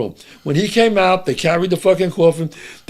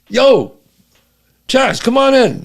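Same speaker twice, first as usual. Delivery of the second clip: raised, higher-pitched hail; calling out; 7 semitones higher, 4 dB louder, slower than usual. Retold or imitated. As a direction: call a pal over to the car. Driver calls him over from car.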